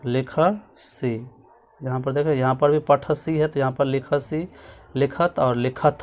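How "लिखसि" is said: लिखसि drawn out very slowly, syllable by syllable; slow careful repeat. Shown as a demonstration. Teacher slowly spelling out लिखसि for students. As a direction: stretch out लिखसि so students catch each sound. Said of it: "क्या हो जाएगा".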